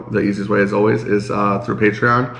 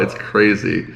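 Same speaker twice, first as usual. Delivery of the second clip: ordinary delivery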